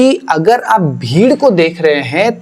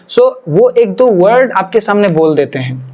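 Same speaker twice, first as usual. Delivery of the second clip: usual speaking voice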